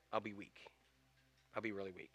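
very soft, low murmur; hushed confession. Acted. Samson caves in to Delilah, hushed confession. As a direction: let it drop almost to nothing, giving in.

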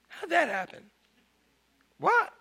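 high, hushed disbelief; mock bafflement jumping up in pitch; shocked, puzzled. Onlooker seeing something inexplicable, shocked and puzzled. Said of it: "you're like kinda shocked".

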